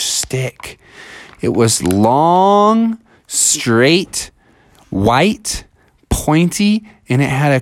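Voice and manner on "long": a long, lingering "long"; drawn-out LONG. A narrator's reading. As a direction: stretch out "long". Word stretched to match meaning.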